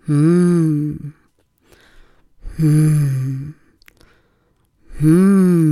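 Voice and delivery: relaxed hums; three easy contented hums. Shown as a demonstration. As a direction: warm mm sounds. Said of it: "Ich mach dir das mal vor".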